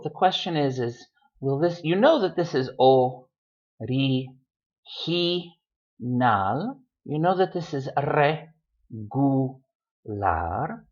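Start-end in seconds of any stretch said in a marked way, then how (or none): 2.8-6.8 "o, ri, hi, nal" in separate syllables with pauses. Shown as a demonstration. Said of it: "how do you know if it's original". 8.0-10.9 "re, gu, lar" in separate syllables with pauses. Shown as a demonstration. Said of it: "How do you know if it's regu".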